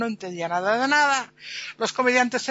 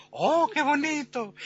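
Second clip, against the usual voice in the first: high, slow "oh" of pretend wonder; feigned admiration; 5 semitones higher, slower than usual. A spectator pretends to see the marvel, high admiring coo. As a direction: coo over something you cannot see. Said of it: "Y así que uno empezó".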